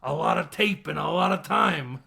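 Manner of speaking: a loud Penguin impression. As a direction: gloat it in a Penguin voice. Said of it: "And he goes".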